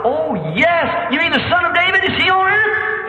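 high, climbing questions; excited realization. Retold or imitated. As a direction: gasp of hope turning into an eager cry. Blind man excitedly learns who comes.

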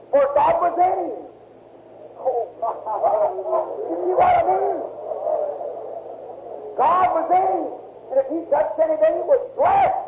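high, ringing revival preacher's call; fervent preaching. Acted. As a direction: lift the congregation with rising fervour. Preaching builds to fervour.

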